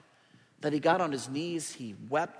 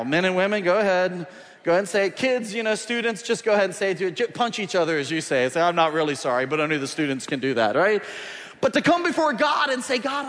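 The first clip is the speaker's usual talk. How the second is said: loud, driving pulpit preaching; preaching at full force; 4 semitones higher, 8 dB louder than usual. Acted. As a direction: push it out to the whole congregation. Preacher pushes congregation to act.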